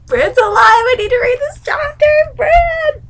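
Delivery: high, loud fangirl shrieking; excited squeal. Real, not acted. Reader desperate for the next chapter, excited shrieking. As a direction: squeal each phrase with urgent glee. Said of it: "when I get excited".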